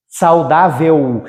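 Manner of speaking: "Saudável" slow and low, final L as U. Shown as a demonstration. Teacher showing how it is pronounced, slow "Saudável" ending in U. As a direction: spell out "Saudável" by ear, ending in U. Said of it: "É assim que eu pronuncio".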